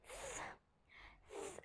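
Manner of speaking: bare "s" hiss of air, no voice; isolated "s" th sound, breath only; voiceless TH. Shown as a demonstration. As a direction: blow "s" as th with tongue between teeth. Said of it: "One is the voiceless one".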